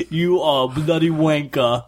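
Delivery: a cheeky insult in a put-on British accent; a laughing mock British jab. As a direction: tease your co-host in a fake British accent. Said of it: "I've been doing this accent the whole time".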